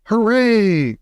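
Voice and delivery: high, loud triumphant cheer. A narrator's reading. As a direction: celebrate the boy's return. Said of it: "cried Mister Wonka".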